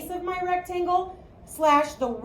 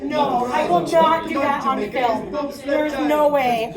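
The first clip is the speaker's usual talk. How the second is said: loud, drawn-out protest; emphatic refusal; 6 dB louder, slower than usual. Real, not acted. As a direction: refuse loudly, stretching the words. Refusing students' request for an accent.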